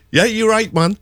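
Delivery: high-pitched Geordie greeting; Geordie dialect. Retold or imitated. Shopkeeper in the Northeast greeting someone, Geordie accent. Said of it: "speaking in the Geordie dialect".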